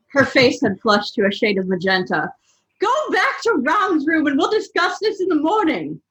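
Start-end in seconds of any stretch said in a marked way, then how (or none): 2.8-6.0 high stern mother; Molly's voice. A narrator's reading. Molly ordering Harry back to Ron's room, stern and high. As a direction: send him off sternly.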